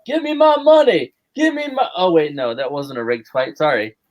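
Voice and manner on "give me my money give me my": high-pitched mock demand. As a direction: holler it playfully as a celebrity guest commentator. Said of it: "we also need [name]".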